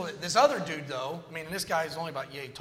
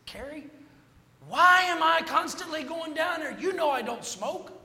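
raised, higher voice, pleading his innocence; exasperated protest; 10 semitones higher, 4 dB louder than usual. Retold or imitated. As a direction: push it up, fed up and insistent. Complaining to his platoon sergeant.

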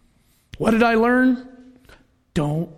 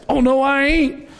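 mock defiant woman; 6 semitones higher than usual. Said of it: "She's cussing this cop".

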